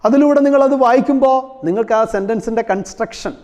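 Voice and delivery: ordinary talk, nothing marked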